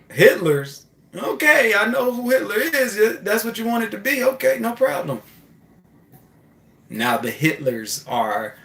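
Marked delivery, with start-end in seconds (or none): 0.1-5.2 high, loud, amused chaos granting the wish; mocking voice of chaos. Chaos answering the driver's prayer about Hitlers, high and mocking. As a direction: play the cosmic force shrugging it off.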